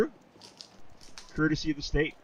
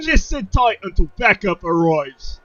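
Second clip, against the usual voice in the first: high, loud British officer's order; British accent; 8 semitones higher, 8 dB louder, slower than usual. Acted. Officer ordering refugees to wait, loud British accent. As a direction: call it out slowly in hammed-up British. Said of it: "How's that British?".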